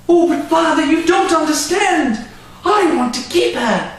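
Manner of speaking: put-on high, pleading girl's voice. Girl begging her father to keep the horse, high and pleading. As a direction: cry out like a desperate child. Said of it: "cried Madeline".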